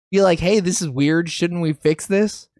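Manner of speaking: ordinary talk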